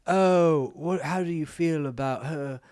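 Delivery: high, sing-song mock Australian producer voice; Australian accent. Retelling how Jowsey mimics producers, in a mock Australian accent. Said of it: "That was my best Australian".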